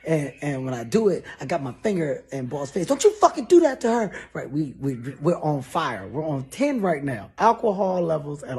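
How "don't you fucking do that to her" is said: high, loud, fast threat; angry yell. Retold or imitated. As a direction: finger in his face, yell. Finger in boss's face.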